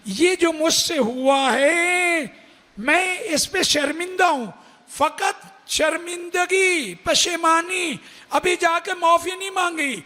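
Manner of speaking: impassioned preaching. Acted. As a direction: lift the voice and stretch each word to the mourners. Rising preaching passage.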